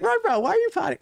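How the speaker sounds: high-pitched teasing challenge; mock incredulous questioning. Host re-enacting confronting his pouting friend, mock incredulous. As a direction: replay it with exaggerated disbelief.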